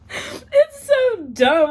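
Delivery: high loud slow squeal; laugh-crying. Real, not acted.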